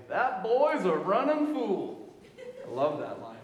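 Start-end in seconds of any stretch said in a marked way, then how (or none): 0.1-2.1 slow, higher old man drawl, lightly voiced; a hint of an old Southern barber. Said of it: "the old men at the barber shop say".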